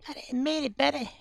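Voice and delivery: high cutesy voice apologising to a viewer; squeaky cute voice. Pretend. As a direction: coo it like talking to a pet.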